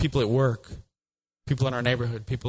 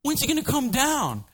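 much higher, whiny impatient crowd; impatient Israelites; 9 semitones higher than usual. Acted. Israelites complain about Moses on the mountain, high and whiny. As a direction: moan like a bored crowd waiting. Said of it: "all the people are like".

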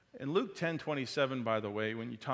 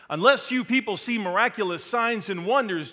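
much louder and higher, a stern rebuke; harsh emphasis; 9 semitones higher, 13 dB louder than usual. Acted. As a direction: hurl Jesus' words like a scolding preacher. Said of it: "I put some pretty harsh emphasis".

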